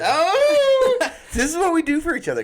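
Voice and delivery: high, loud, laughing, fast; overjoyed at the gift; delighted surprise. Real, not acted.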